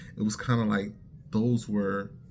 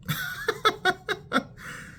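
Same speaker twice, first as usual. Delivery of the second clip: high laugh. Real, not acted. Laughing at his mistake.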